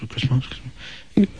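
nudging a mate without being overheard; hushed nudge. Retold or imitated. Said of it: "nudged his mate and he goes".

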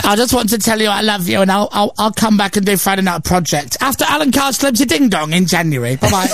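high-pitched mock voicemail impersonation; Alan Carr. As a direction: gush into the answerphone in a put-on voice. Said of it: "it's Alan".

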